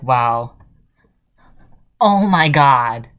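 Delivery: slow, loud mock amazement; mock awe. Mocking the Grammys hearing Yummy, slow loud fake awe. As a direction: ham up being deeply moved. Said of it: "You're like".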